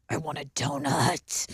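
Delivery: put-on low, slow possessed-child growl; little demon voice. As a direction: drag it out like a possessed kid. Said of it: "talk like a, a little demon".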